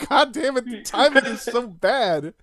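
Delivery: high, loud wail of mock dismay; mock pissed-off. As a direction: wail it like comically outraged bad news. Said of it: "I was about to be pissed".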